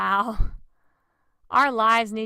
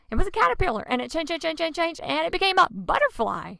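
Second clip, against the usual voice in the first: higher, louder storyteller build; excited; 7 semitones higher, 5 dB louder, faster than usual. Acted. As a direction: thrill the kids with the change.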